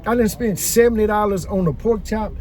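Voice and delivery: ordinary delivery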